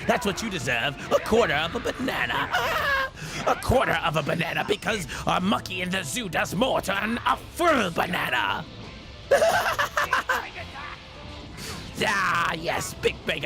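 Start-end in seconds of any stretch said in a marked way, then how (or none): 2.3-3.1 high, hammed-up mocking cackle; villain laugh. Villain laughs at own banana taunt, mocking. 8.7-10.9 long, high villain cackle; evil laugh. Acted. Villain revels in the insult to the foe, evil laugh.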